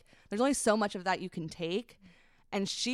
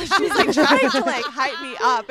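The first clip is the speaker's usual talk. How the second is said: laughing; 6 semitones higher, 12 dB louder than usual. Real, not acted. Laughing while retelling friend's hype.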